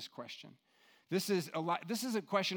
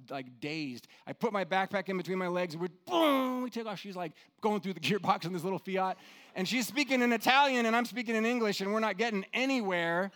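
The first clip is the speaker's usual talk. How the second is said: ordinary talk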